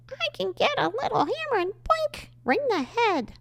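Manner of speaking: high squeaky cartoon mouse voice; Minnie Mouse. Shown as a demonstration. Host demonstrating silly voices on a dark thought, squeaky cartoon mouse. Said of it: "that was supposed to be Minnie Mouse".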